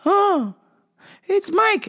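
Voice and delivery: high, halting confused customer. Retold or imitated. Customer asking the clerk for the vitamin man, high and confused.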